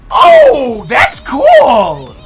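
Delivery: loud, high burst of delight; big excited fan reaction; thrilled. Real, not acted. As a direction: blurt it out, genuinely wowed. Delighted reaction to knight reveal.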